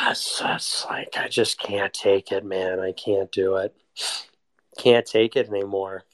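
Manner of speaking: low, worn-out complaint; drained, low-voiced grumbling after a sigh; weary exasperation. Real, not acted. Fed up after a heavy sigh.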